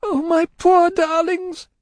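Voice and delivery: high, slow, distraught wail; anguished lament. A narrator's reading. Miss Pross lamenting her poor darlings, anguished. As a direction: draw it out in grief.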